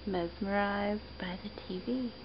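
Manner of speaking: soft whispered aside; whispering. Real, not acted. Quiet aside while baby watches.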